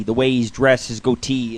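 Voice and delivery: ordinary delivery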